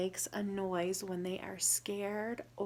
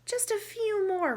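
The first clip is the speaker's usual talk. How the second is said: high, loud eager bunny voice; eager Rabbit; 12 semitones higher, 7 dB louder than usual. Acted. Rabbit begging Squirrel for more carrots, high and eager. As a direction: squeak it out, keen for more carrots.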